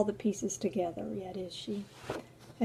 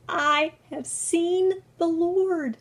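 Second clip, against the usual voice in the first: joyful announcement; 8 semitones higher, 7 dB louder, much slower than usual. Acted. Mary announces the news.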